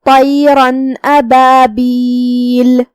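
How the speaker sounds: طیرا ابابیل very slowly, with a clear n before the alif and no humming; طیرا ابابیل with a clear nasal-free n; clear noon of tanween. Shown as a demonstration. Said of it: "تنوین کو ظاہر کریں گے".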